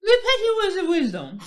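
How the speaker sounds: high, loud put-on diva voice; put-on lady voice. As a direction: sign off as some fancy lady. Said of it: "I don't know who she is".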